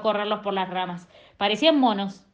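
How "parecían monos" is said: usual speaking voice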